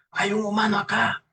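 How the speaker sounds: high, strained devil voice; suspicious devil, pitched well up; furious devil. Acted. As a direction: sniff out an intruder as an angry devil. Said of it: "todo furioso, refunfuñando".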